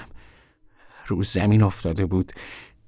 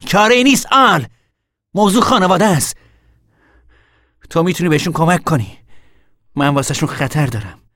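raised voice, high then gradually easing; insistent arguing; 9 semitones higher, 7 dB louder than usual. Acted. Older brother insists family needs him, arguing forcefully. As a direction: press the point loudly, then ease off. Argues brother must stay.

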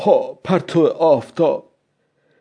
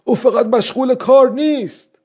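raised voice; 7 semitones higher than usual. A narrator's reading. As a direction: say it up and out, as if speaking aloud to yourself. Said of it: "بعد با صدای بلندتری گفت". (با صدای بلندتر)

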